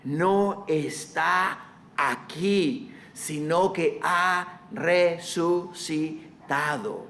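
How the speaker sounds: very slow, each word set apart; slow, reverent proclamation; slow solemn proclamation. Acted. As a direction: announce the resurrection slowly. Slow announcement of resurrection.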